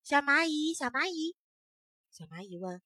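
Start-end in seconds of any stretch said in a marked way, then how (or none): 0.0-1.4 higher, loud calling out. Acted. Firefly calls after the ant, high and loud. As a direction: call after the busy ant. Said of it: "萤火虫就叫".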